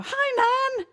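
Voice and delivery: chirpy greeting. Retold or imitated. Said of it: "and I go".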